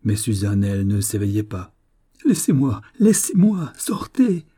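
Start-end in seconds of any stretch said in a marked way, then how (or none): 2.2-4.4 high-pitched, urgent exclamations; panicked cry. A narrator's reading. Young woman seized by the officer, crying out in panic. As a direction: cry out higher with each plea. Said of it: "cria encore Dolorita".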